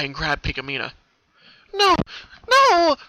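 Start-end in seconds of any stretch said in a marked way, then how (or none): 1.7-3.0 very high, very loud screaming no; terrified cry. Acted. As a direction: scream to resist being taken. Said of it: "Pinkamena cried out".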